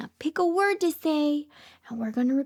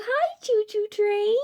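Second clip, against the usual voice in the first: normal talking voice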